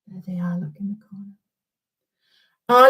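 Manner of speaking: unvoiced secret whisper; hushed whisper. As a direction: point it out in a whisper. Hushed pointing in tomb.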